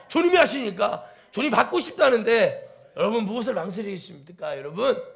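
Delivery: loud, high, full-throated sermon climax; fiery preaching. Acted. Pastor rousing the congregation to give everything to the Lord, shouting with fervor. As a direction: preach it hard, calling out to the hall.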